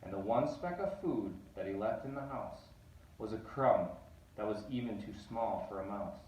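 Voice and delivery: plain reading voice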